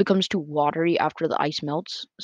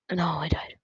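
whispering; 3 dB quieter than usual. Real, not acted. Tense moment, dies in game.

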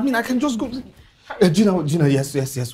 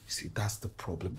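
lower, softer, defeated; despairing; 10 semitones lower, 12 dB quieter than usual. Acted. Husband telling doctor wife refuses, quiet despair. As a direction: say it as if the fight is lost.

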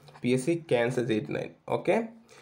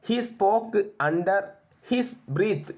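very slow, higher-pitched reading; slow dictation; 6 semitones higher, much slower than usual. Shown as a demonstration. Teacher reading the English phrase to be translated, slowly drawn out. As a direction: draw out each English word.

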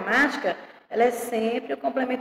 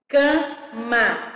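"Cama" in two separated beats, ca-ma; syllable by syllable. Shown as a demonstration. Teacher showing pupils two syllables, syllable by syllable. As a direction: break "Cama" apart between syllables. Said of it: "Duas sílabas".

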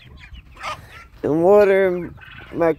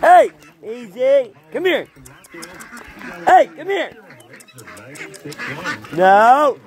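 calling out; 7 semitones higher than usual. Real, not acted. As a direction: yell the dog back over. Calling the dog Jumpy back.

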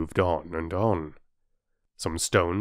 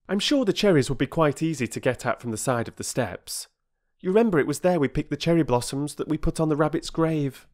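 put-on higher, quick boyish chatter; eager boy Peter; 6 semitones higher, faster than usual. A narrator's reading. Boy coaxing his sisters toward the cherries, quick and higher. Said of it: "said Peter".

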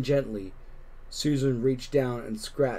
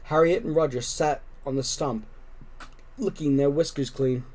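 plain reading voice

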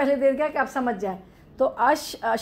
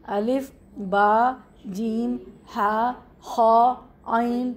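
slow, drawn-out letter names; letter recitation; much slower than usual. Shown as a demonstration. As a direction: list the Arabic letters one at a time, stretching each.

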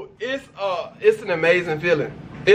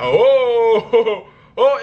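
rising, louder delighted oh oh with a laugh; excited; 4 semitones higher, 4 dB louder than usual. Retold or imitated. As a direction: gasp out a joyful oh oh. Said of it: "and I was like".

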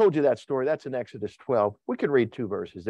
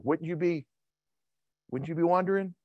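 ordinary talk

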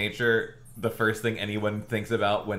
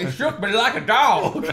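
high, loud echo of the cartoon voice; Monsters, Inc. impression; 12 semitones higher, 5 dB louder than usual. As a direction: repeat it bigger, breaking into laughter. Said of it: "The guy that's like".

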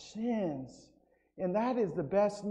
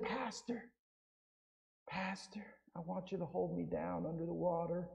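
hushed plea; 12 dB quieter than usual. Retold or imitated. Woman pleading with the pastor.

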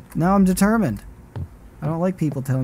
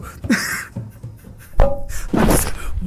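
breathless nervous laugh. Real, not acted. Laughs after the scare.

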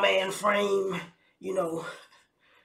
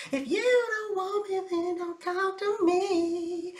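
much higher, slow, drawn-out mock diva; diva singer voice; 11 semitones higher, slower than usual. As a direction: croon it like a wounded R&B diva. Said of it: "That's what y'all saying?".

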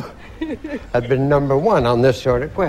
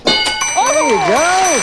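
game show host; 3 dB louder, much slower than usual. Real, not acted. Host cheers correct answer.

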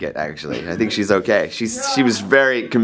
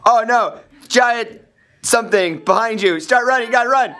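panicked shouting; 13 semitones higher than usual. Pretend. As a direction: shout in mock terror. Narrating monster chase, urging run.

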